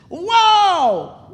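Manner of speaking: loud, high, long-drawn wow; astonished wow. Acted. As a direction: play a king going crazy with amazement. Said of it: "like, going crazy from seeing this parrot".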